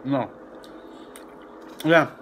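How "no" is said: ordinary delivery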